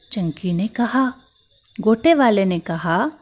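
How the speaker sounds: ordinary delivery, nothing marked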